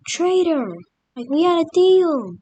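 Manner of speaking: high, loud cry of betrayal; betrayed outrage. Retold or imitated. Italy yelling at its former ally, outraged. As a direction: yell it like a stabbed-in-the-back ally.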